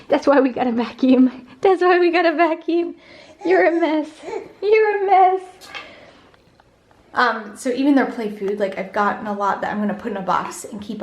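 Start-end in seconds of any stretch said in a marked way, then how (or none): 0.1-5.4 high sing-song teasing. Real, not acted. Parent to little one making a mess, playful baby talk. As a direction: coo a mock scolding in a high voice. Playfully scolding Adam for eating.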